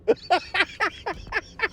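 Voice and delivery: high squealing laugh. Real, not acted. Laughing at the cousins who ask what a synagogue is. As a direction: burst into a high giggle.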